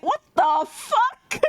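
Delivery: slow, high, drawn-out disbelief; mock outrage. Reacting to him saying you're a girl, mock outraged. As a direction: stretch each word in indignant mock shock.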